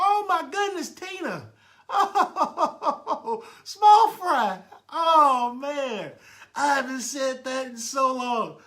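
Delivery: loud, high burst of delighted laughter; overjoyed cry to a long-missed friend; thrilled greeting. Real, not acted. Delighted to see old friend Tina.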